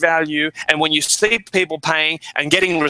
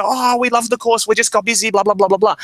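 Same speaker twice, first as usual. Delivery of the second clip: imitating happy customers; 5 semitones higher than usual. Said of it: "They go".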